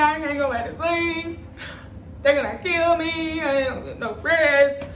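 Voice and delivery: high, whiny hammed-up wail; panicked mock crying. Re-enacting the 2 AM call to mom, mock tearful panic. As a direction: wail it like a tearful, exaggerated complaint. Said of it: "I was just panicking".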